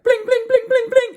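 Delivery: very high sung harp plinks; harp strumming. Retold or imitated. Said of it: "he's like".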